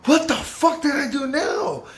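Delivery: high, drawn-out disbelief; shocked. Retold or imitated. Resident called into the middle, shocked high protest. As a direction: act out being stunned at the accusation. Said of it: "I go literally shocked".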